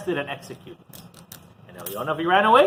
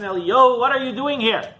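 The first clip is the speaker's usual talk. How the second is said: higher, louder calling question; God calling the hiding prophet by name; 9 semitones higher, 6 dB louder than usual. Acted. God asking the prophet in the cave, higher and louder.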